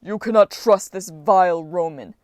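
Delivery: loud, rumbling giant's warning; a giant bellowing his warning to the goddess; angry bellow. Acted. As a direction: roar it to turn her against Jason. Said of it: "the giant bellowed".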